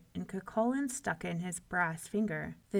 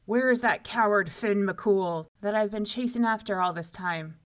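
loud, blustering giant; giant's bellow; 8 dB louder than usual. A narrator's reading. Giant hunting for Finn McCool, bellowing. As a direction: boom it out like a bully giant. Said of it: "bellowed the giant at the door".

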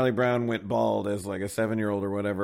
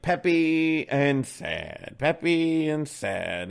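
slow, drawn-out mournful chant; slow mock lament; 4 semitones higher, much slower than usual. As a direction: drag it out like a sad dog. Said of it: "and he goes, like".

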